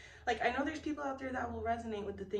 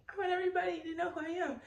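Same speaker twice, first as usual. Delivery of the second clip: mock diva; 10 semitones higher, much faster than usual. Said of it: "It's not like".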